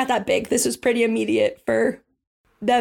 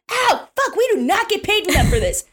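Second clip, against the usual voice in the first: higher-pitched grumbling minion; complaining kobold; 7 semitones higher than usual. Acted. Burned kobold grumbles about pay, higher and whiny. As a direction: whine it like a put-upon henchman. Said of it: "you just hear him go".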